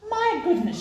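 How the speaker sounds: mother's startled high exclamation; surprised cry. A narrator's reading. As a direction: cry it out in alarm. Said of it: "she cried".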